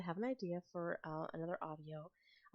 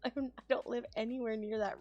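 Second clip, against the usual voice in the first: laughing; 6 semitones higher, 9 dB louder than usual. Real, not acted. Laughing surprise at Jersey remark.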